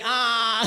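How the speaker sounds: "A" held long with mouth wide open. Shown as a demonstration. Coach showing mouth exercise, wide-open A. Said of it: "A-nya harus tiga jari".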